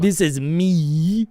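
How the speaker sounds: weird put-on voice. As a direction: stretch it out in a dopey voice. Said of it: "what kind of voice this is".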